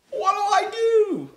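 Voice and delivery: very high slow panicked wail; a turkey wailing in panic. Acted. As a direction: wail it in terror. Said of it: "Lance wailed".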